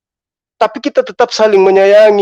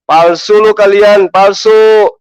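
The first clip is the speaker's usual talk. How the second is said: ordinary delivery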